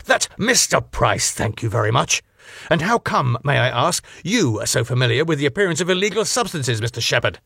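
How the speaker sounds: high, icy, pompous reproach; open hostility. Acted. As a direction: sneer down at him. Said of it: "with open hostility".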